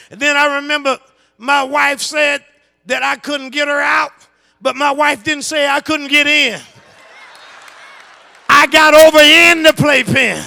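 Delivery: high, loud, sustained pulpit shout; fervent full-force preaching. Acted. Preacher tells believers God will come in with them, fervent shouted preaching. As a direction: drive each line home to the congregation.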